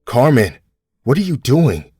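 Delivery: husband horrified at wife's rage; shocked call. A narrator's reading. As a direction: stop her in alarm.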